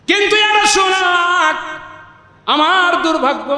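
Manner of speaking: high, chanted appeal; plaintive lament. Acted. Woman tells the Prophet of her misfortune, plaintive lament. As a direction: call out the misfortune on high, held notes.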